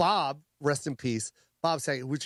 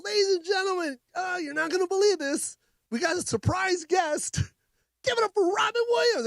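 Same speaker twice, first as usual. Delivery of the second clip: much higher, hyped announcer voice; excited emcee intro. Retold or imitated. Comic retelling his stage intro of Robin, hyped emcee announcing. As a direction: sell the surprise guest to a roaring room.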